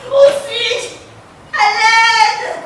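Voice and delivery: high, loud panic; panicked shouting. Acted. Woman believing she was kidnapped, shouting in panic. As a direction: shout in terror.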